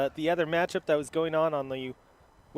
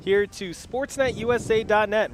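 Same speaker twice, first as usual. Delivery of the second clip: announcer; 5 semitones higher, 5 dB louder than usual. Acted. Broadcast opening welcome.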